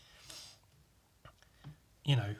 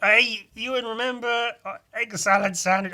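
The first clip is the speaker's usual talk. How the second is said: loud, higher eager callback; awkward friend; 9 semitones higher, 6 dB louder than usual. Retold or imitated. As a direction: nudge him with the old joke. The friend brings up the joke again.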